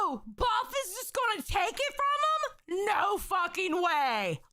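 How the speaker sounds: high, slow shouted disbelief; shocked outburst. Real, not acted. Viewer reacting to a twist on stream, shocked shout. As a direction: yell it in total disbelief.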